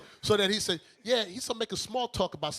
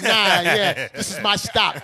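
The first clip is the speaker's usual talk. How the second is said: hasty exit line; 8 dB louder, slower than usual. Retold or imitated. Acts out escaping at the stop.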